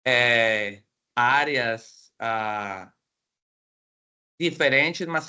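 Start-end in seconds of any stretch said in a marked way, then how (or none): none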